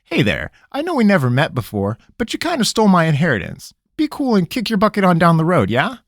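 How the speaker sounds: a cornered trickster pleading; nervous country rogue. Acted. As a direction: squirm and stall, talking fast. Pickett mock speech.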